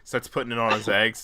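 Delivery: usual speaking voice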